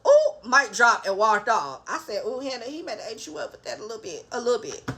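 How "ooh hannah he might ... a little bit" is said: quiet teasing. Real, not acted. Teasing aside to Hannah.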